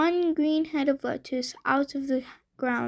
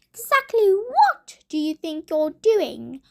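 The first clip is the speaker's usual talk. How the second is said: high, loud witch voice; shrill it out like a witch; 4 semitones higher, 5 dB louder than usual. A narrator's reading. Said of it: "came a high, loud voice".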